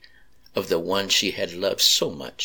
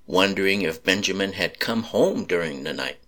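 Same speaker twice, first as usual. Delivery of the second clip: ordinary delivery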